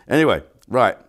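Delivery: normal talking voice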